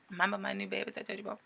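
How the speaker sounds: normal talking voice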